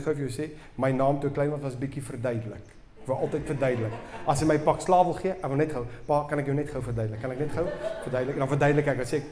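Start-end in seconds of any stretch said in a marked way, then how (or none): 6.1-8.3 fast, soft, hurried begging; rushed pleading. Retold or imitated. Boy to his dad before a spanking, fast soft pleading. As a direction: talk your way out of the hiding.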